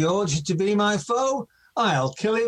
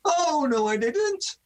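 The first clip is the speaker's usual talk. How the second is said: high panto denial; 10 semitones higher than usual. Acted.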